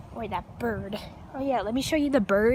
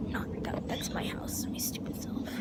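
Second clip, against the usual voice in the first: soft unvoiced whisper; 9 dB quieter than usual. Real, not acted. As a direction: whisper like you're hiding. Hiding, pointing out the neighbours.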